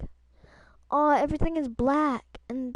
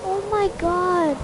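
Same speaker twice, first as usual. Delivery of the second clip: stunned; 7 semitones higher, 9 dB louder than usual. Real, not acted. Shocked at game ending.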